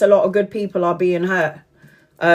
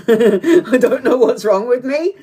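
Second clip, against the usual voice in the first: higher, slow words through laughter; laughing; 4 semitones higher, 4 dB louder, slower than usual. Real, not acted. Admitting she wants to be left alone, laughing. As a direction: laugh at yourself as you say it. Laughs at her own contradiction.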